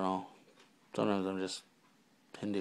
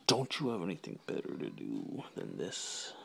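lower, softer put-on voice; mock interrogation; 6 semitones lower, 10 dB quieter than usual. Vlogger questioning himself about the video, low mock-probing voice. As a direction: ask it low, sinking away.

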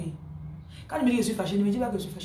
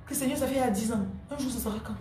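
higher, pleading complaint to the Lord, only lightly voiced; a hint of a whining believer asking when; mock impatient prayer; 5 semitones higher, 6 dB quieter than usual. A believer tired of waiting complains to the Lord, mock pleading. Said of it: "Un jour, Dieu va m'aider".

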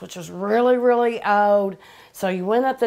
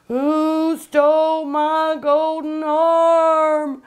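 higher, louder, very slow haunting wail; louder ghost wail; 6 semitones higher, 4 dB louder, much slower than usual. Retold or imitated. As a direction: let the ghost's cry swell and hang. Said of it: "at the end she'd say".

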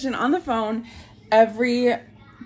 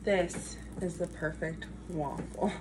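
usual speaking voice

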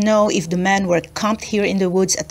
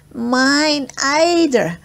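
put-on mousy girl voice; 5 semitones higher, much slower than usual. Smokey Joe agrees.

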